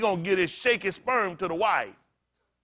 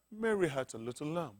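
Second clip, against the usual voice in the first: soft, lower mock proper diction; softer mock nursery recital; proper-talking voice; 7 semitones lower, 13 dB quieter than usual. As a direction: put on a prim, proper reciting voice. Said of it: "I can't talk like that now".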